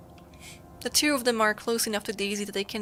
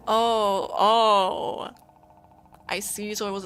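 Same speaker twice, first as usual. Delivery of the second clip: louder, crying gasp of realization; choked-up oh; 4 dB louder, slower than usual. Real, not acted. Player realizing the satellite's role, choked with tears. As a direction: it suddenly hits you and you well up.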